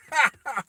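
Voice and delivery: high, short burst of laughter; acted laugh. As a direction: laugh as the villain. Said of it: "he starts laughing".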